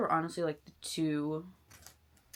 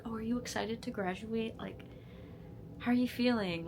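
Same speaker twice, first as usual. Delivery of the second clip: higher-pitched mock questioner; mimicking eager askers; 5 semitones higher than usual. Friends asking about her graduation, mimicked eagerly. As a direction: put on a higher, excited asker's voice. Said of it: "times I've been asked".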